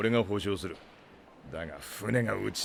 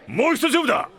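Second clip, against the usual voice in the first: higher and louder, stubborn; defiant challenge; 5 dB louder than usual. Acted. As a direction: slap down a demand for a rematch. Captain demands another round.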